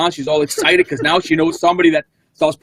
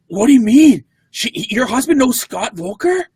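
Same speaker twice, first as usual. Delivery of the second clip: surprised; 9 semitones higher than usual. Retold or imitated. Retold lady surprised.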